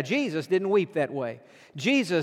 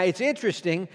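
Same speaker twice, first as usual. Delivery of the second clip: ordinary talk, nothing marked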